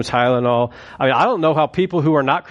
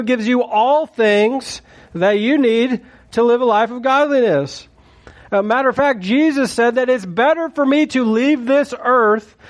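raised, swelling sermon voice; preacher pressing the point home, high and driving; fervent preaching; 7 semitones higher than usual. Acted. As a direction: lift the congregation with rising passion. Preaching at full force.

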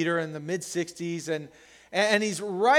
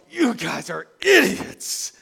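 exasperated dad; 6 semitones higher, 9 dB louder, slower than usual. Retold or imitated. Dad scolding noisy family at dinner.

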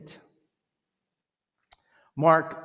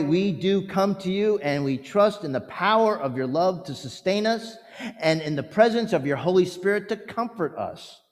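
higher, louder fervent prayer voice; pastoral prayer; 5 semitones higher, 7 dB louder than usual. Acted. Pastor praying over the congregation, fervent. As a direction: pray aloud for the grieving.

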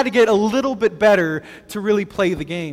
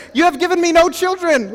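raised, higher-pitched protest; impatient complaint; 9 semitones higher, 4 dB louder than usual. Acted. Abram repeating his grievance to God, acted impatient complaint. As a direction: complain as if to someone hard of hearing. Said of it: "As if God was deaf".

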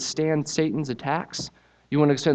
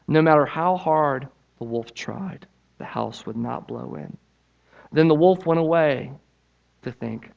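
lively storybook reading, pitched up; story narration; 5 semitones higher, 6 dB louder than usual. A narrator's reading. Preacher reading the pigs story to church, animated. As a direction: tell it like reading to children. Reads children's story aloud.

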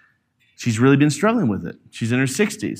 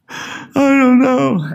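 retold upset sigh; 10 semitones higher, much slower than usual. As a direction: breathe out hard, then a drawn-out high "don't know". Retold son letting out upset.

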